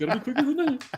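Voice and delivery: high, put-on taunting voice; commercial voice impression. Echoing the State Farm ad to his cohost, high and mocking. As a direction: squeak the ad line in a high mock voice. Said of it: "State Farm commercial".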